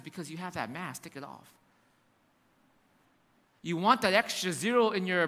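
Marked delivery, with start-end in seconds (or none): 0.0-1.5 gentle voice of God. Acted. God's voice quoted gently.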